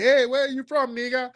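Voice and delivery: high mock greeting voice; imitating an African. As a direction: pitch it up like a curious African calling out. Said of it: "They're like".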